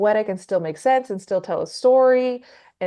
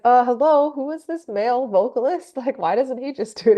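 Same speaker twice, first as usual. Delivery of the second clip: amazed mock outrage; 4 semitones higher, 4 dB louder than usual. Retold amazed reaction to guide vocal.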